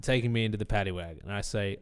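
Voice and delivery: normal talking voice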